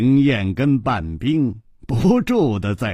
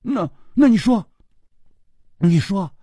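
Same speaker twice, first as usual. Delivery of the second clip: higher, hurt, halting question; tearful demand; 6 semitones higher than usual. A narrator's reading. Girl asks why he rejects them, upset and halting. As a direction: press him as if close to tears. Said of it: "半冰红着眼睛说".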